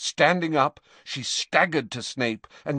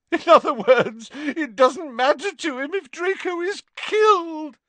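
high, raised voice of outrage; bitter Narcissa; 5 dB louder than usual. A narrator's reading. Narcissa seeing through the Dark Lord's plan, high and bitter.

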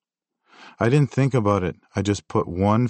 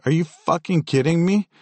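angry; 9 semitones higher, 3 dB louder than usual. Retold or imitated. Angry retort at friend.

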